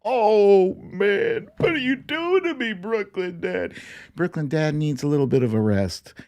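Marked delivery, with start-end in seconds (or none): none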